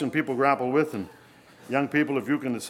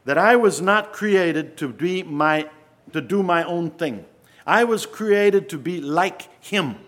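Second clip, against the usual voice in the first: louder, higher, slow declaration; preaching; 7 semitones higher, 6 dB louder, slower than usual. Acted. Preacher declaring why people were created, loud and slow. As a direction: lean into each word like a creed.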